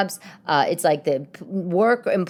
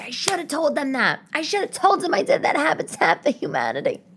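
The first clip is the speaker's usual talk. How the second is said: high-pitched mock lament; acted regret; 9 semitones higher than usual. Candidate kicking herself after job interview, mock lament. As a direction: whine the missed chance in a high voice. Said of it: "you're like".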